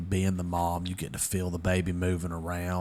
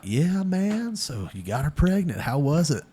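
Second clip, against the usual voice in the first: much higher, louder dude voice; imitating a bro; 8 semitones higher, 3 dB louder, slower than usual. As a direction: play the nudging buddy. Guys ribbing him about the pregnancy.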